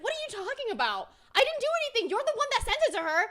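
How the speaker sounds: high, loud, indignant comeback; frantic mock denial. Streamer acts out the accused friend firing back, indignant. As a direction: throw the blame back, high and quickening. Retold quarrel, friend denies blame.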